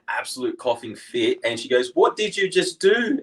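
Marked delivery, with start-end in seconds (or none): 1.9-3.2 high, slow, accusing question; mock-outraged wife voice; alarmed wife. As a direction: imitate a wife demanding an explanation. Acts out coughing wife confronting him.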